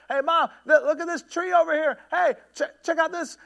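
high, loud shout over a roaring waterfall; shouting over noise. Retold or imitated. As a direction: yell to be heard over the falls. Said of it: "He keeps having to shout".

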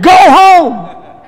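high, loud, angry bark; angry doctor shout. Retold or imitated. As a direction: yell it like the furious doctor. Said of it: "And that's the way he said it".

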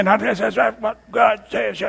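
sing-song preachy intonation; mock preacher. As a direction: parody a pompous preacher. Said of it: "preachers don't want to just come out and say".

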